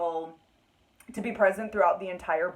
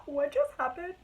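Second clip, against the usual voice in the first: disbelieving rise; 6 semitones higher than usual. Retold or imitated. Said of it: "I was like".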